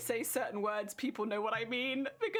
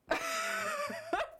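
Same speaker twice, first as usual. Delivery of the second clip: high shrieking laugh. Real, not acted. Laughing at her own lazy slang, high shriek of laughter. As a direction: burst into a high squealing laugh.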